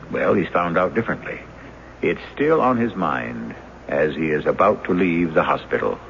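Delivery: measured announcer read, even and steady; radio drama narrator. A narrator's reading. Announcer introduces the episode to listeners, measured narration. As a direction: set the scene for listeners like an old radio announcer.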